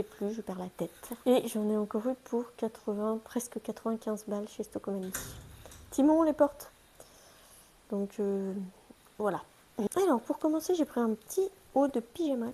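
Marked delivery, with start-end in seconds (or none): 5.9-6.7 sharp call to the dog, louder and higher; firm call across the room. Real, not acted. Calling the dog from doors.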